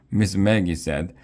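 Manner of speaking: ordinary delivery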